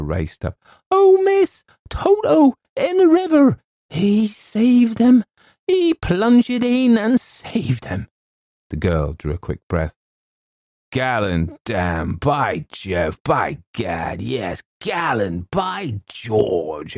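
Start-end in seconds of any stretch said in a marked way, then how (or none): 0.9-8.1 very high, loud squeal; excited housekeeper. Acted. Housekeeper races up with urgent news. 10.9-17.0 put-on slow, drawn-out old-soldier exclaiming; soldierly exclamation. A narrator's reading. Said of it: "exclaimed the soldierly man".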